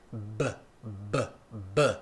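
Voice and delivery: repeated B, low and soft; isolated B sounds. Shown as a demonstration. Teacher drilling the B sound, soft repeated pops. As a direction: pop the B slowly for students to copy. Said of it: "Repeat after me".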